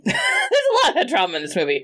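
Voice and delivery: high, laughing echo of the joke; laughing repeat. Real, not acted. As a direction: laugh through the line.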